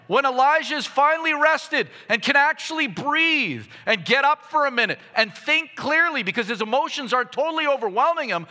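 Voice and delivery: high, loud pulpit delivery; impassioned preaching. Real, not acted. Preacher contrasts God's care for Elijah with harsh rebuke, impassioned.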